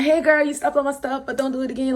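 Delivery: high, mock-light telling-off; mock-friendly. Sarcastically acting easygoing about her stepped-on stuff, mock-friendly. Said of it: "you want me to laugh?".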